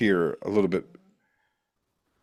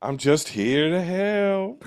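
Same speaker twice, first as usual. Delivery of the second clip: very slow, high, drawn-out piety; polite preacher hiding terror behind his offer; Reverend Kane; 9 semitones higher, much slower than usual. Acted. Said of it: "that polite religiosity when it's just absolute".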